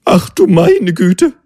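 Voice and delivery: hammed-up shock of falling in love; lovestruck little creature. A narrator's reading. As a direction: gasp out the realisation, giddy. Realises it is in love.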